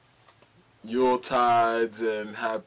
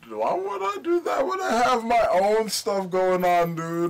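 higher, louder indignant protest; 10 semitones higher, 6 dB louder than usual. Real, not acted. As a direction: push back, offended. Indignant denial.

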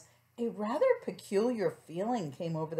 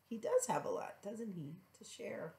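gentle hushed comment; soft aside; 9 dB quieter than usual. Real, not acted. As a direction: quietly point out the picture. Asides to child over picture book.

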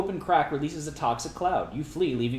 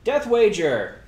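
announcing loudly; 7 semitones higher, 9 dB louder, much slower than usual. Acted. Announcing event title.

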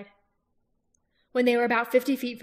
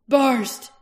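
loud, higher call of a name across a battlefield; shouted challenge; 4 semitones higher, 11 dB louder than usual. Acted. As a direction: bellow the enemy's name. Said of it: "he shouted again".

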